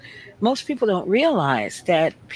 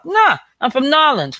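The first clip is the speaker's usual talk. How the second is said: higher and louder New Orleans reply; New Orleans Yat accent; 7 semitones higher, 5 dB louder than usual. Acted. Speaker acting a local answering a confused listener, Yat accent. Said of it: "they think".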